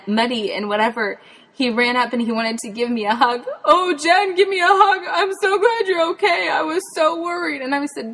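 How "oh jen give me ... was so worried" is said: high, choked-up relieved voice; tearful relief. Retold or imitated. Relieved dad hugging muddy Jen, high and tearful. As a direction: sound close to tears with joy.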